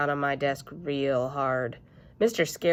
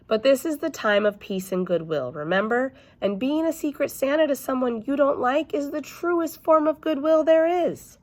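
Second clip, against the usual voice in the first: coaxing teacher; 9 semitones higher, 3 dB louder than usual. Acted.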